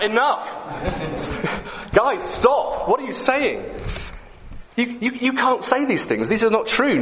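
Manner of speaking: high, outraged stop-it cry with a laugh; acted prophet's shout. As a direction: burst out like an Old Testament prophet. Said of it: "and shout".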